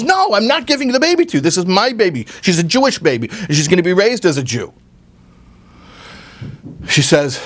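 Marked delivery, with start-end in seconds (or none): 0.0-4.7 much higher, fast and outraged; mother refusing the farmer's wife, indignant and high; indignant refusal. Retold or imitated. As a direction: refuse fiercely, protecting her baby. Mother refuses to give baby.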